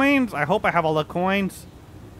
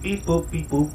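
robot voice; 7 semitones lower than usual. Pretend. Plays a robot in game.

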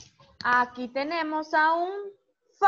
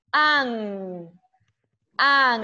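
"an" said loudly and clearly, the n sounded out; teacher hammers home "an" instead of "a"; stressing an; 3 semitones lower, 4 dB louder than usual. Shown as a demonstration. Teacher showing students to say an, stressed. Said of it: "ya no voy a decir a, sino".

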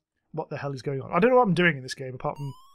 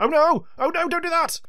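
high, loud panicked pleading; panicked cry; 11 semitones higher, 4 dB louder than usual. Real, not acted. Gamer begging the robot not to hurt him, panicked.

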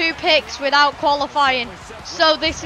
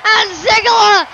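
ordinary talk

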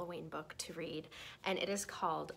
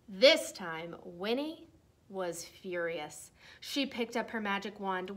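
lively storybook read-aloud voice; reading to children; 3 semitones higher, 4 dB louder than usual. Acted. Teacher reading a Halloween book to her class, lively read-aloud.

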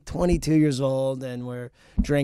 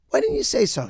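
much higher, imitated woman's voice; delighted woman neighbor; 10 semitones higher, 3 dB louder than usual. Woman neighbor on learning he's a comedian, high delighted imitation. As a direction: pipe up as a suddenly won-over neighbor.

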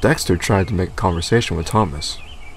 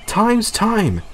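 much higher curt rebuke; stern Thomas; 13 semitones higher than usual. A narrator's reading. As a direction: scold the late engine. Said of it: "said Thomas".

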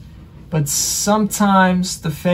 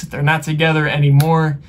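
ordinary delivery

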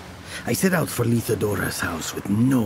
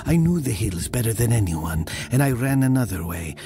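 ordinary delivery

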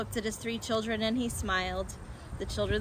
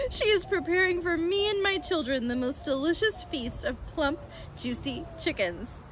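higher, tickled reading voice; amused retake; 6 semitones higher than usual. A narrator's reading. Narrator rereading Fox's line after a slip, amused. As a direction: read on through leftover amusement.

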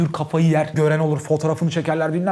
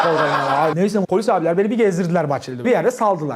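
ordinary talk, nothing marked

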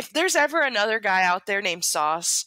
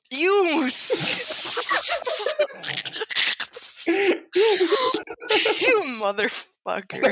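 laughing; 11 semitones higher, much slower than usual. Real, not acted. Laughing at Trevor Lopez joke.

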